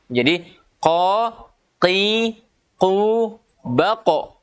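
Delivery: qa, qi, qu said slowly with the tongue back and lips still; teacher drilling qa qi qu; qaf syllables drill. Shown as a demonstration. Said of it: "Disitulah makhrajnya huruf qaf".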